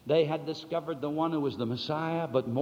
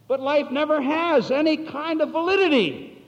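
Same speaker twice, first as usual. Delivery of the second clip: higher and louder fervent declaration; preaching at full force; 10 semitones higher, 11 dB louder than usual. Acted. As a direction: proclaim it with conviction.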